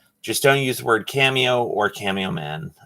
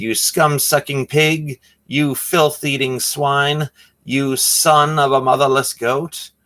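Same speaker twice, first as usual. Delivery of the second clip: higher, slow, hammed-up heroic defiance; movie quote bravado; 5 semitones higher than usual. Quoting Three Amigos to the clerk, hammed-up heroic insults. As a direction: declaim it like a swashbuckling movie hero. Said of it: "those are lines from one of my favorite movies".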